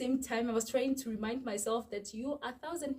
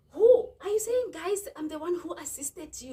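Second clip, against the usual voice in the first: indignant waitress; 8 semitones higher, 7 dB louder than usual. Retold or imitated. Retold waitress denying payment.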